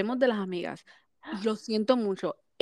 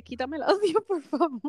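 higher, laughing through a pretend plea; laughing mock plea; 6 semitones higher than usual. Podcast host voicing the mother, laughing plea. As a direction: beg through giggles to cut the audio. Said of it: "como la mamá de él".

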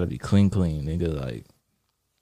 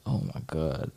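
stunned hush; 4 semitones lower, 10 dB quieter than usual. Retold or imitated. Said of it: "I'm just sitting there like".

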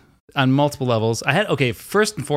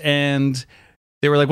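ordinary delivery

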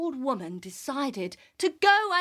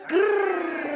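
tiger growl; 6 semitones higher, 3 dB louder than usual. Acted.

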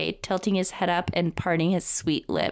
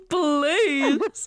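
high, hammed-up whimpering plea with a laugh; mock pleading; 8 semitones higher, 3 dB louder than usual. Host mimics Kalen begging the boss, mock pleading. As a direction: plead in a silly high whine.

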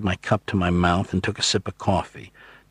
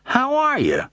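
very high, cheery phone greeting; pleased and cheerful. A narrator's reading. Ben to Miles on long-distance: delighted, cheerful. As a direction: sing out a happy hello. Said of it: "The voice was suddenly pleased and cheerful".

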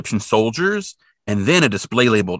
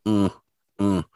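mummy grunts; 4 dB quieter than usual. Retold or imitated. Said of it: "that mummy walk... He's kinda like".

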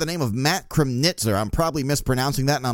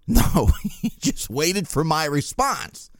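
slow, laugh breaking into the words; amused, laughing retelling; slower than usual. Real, not acted. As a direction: chuckle your way through the letdown. Laughs while telling the awkward wait.

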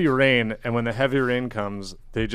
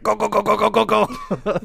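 high, loud crab chant; 11 semitones higher, 7 dB louder than usual. Retold or imitated. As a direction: cheer like tiny crabs in a stampede. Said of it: "they're all just like".

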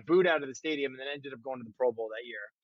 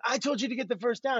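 higher, half-hearted imitation of the coach yelling; mock coach yell; 9 semitones higher, faster than usual. Quarterback imitating the coach yelling the same order at his teammate, mock yell. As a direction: repeat the coach's yell, lightly hammed. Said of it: "he would yell you the same thing".

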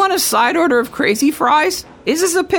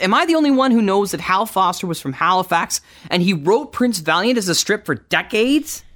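usual speaking voice

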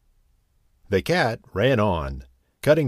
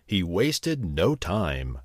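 ordinary delivery

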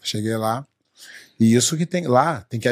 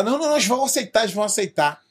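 hurried reassurance; 7 semitones higher, faster than usual. Retold or imitated. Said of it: "Aí o cara falou".

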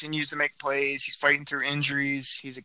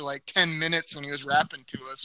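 ordinary talk

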